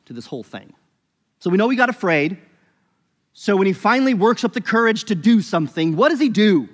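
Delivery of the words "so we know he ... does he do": raised, louder preaching voice; fervent sermon push; emphatic preaching. Acted. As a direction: drive the point home from the pulpit. Building to a rhetorical question.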